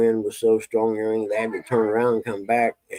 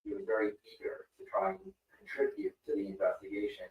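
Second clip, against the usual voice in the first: distant muffled tv dialogue; tv through the wall; 16 dB quieter than usual. Real, not acted. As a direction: background television bleeding in. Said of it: "Sorry about the T V noise in the background".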